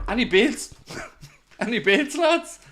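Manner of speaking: high, laughing "any bids, lads"; mock auctioneer. Streamer jokingly auctioning to viewers, laughing high. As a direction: giggle through a cheeky auction call.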